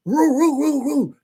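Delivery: high barking woofs, imitating a Doberman; mock dog bark. Imitating the Doberman that charged out, high mock barks.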